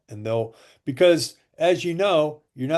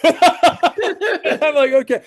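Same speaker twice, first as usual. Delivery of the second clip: high, loud laughter through the words; real laugh bursting into the quote; 14 semitones higher, 7 dB louder, much slower than usual. Real, not acted. Salesman recalling an easy sale of machines, laughing.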